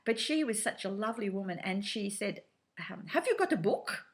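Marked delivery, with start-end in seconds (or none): 3.1-4.1 put-on high-pitched question in German accent; lilting, high German-accented query. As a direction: imitate the German lady, pitched well up. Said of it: "please excuse the German accent".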